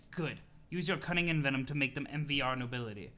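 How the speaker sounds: usual speaking voice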